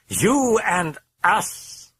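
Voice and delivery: high, very slow, savouring demon voice; menacing drawn-out demonic promise. A narrator's reading. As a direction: let it crawl out word by word. Demon taunts Karras.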